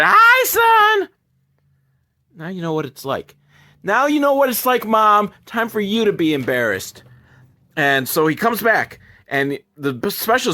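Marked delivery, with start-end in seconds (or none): 0.0-1.1 very high, loud mock holler; embarrassing call. Mom embarrassing son at places, imitated high shout. 2.3-3.4 softer, understated retort; quiet aside. Retold or imitated. Son getting back at mom, quiet retort. First, low take before louder repeat. 3.8-7.0 gloating payback. Retold or imitated. Son telling mom it's her turn, mock taunting.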